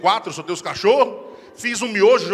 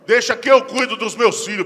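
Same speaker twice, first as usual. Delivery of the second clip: loud, higher, slow protective scolding; mock scolding; 4 semitones higher, 9 dB louder, slower than usual. Husband loudly repeating the wife's scolding line. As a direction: throw her words back loudly.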